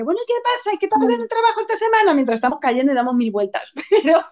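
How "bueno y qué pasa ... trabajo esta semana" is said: much higher, breezy and quick small talk; chatting as if nothing is happening; casual chit-chat. Retold or imitated. Chatting to a friend mid-rollercoaster, carefree and fast. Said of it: "voy a hablarte por el camino y te diré".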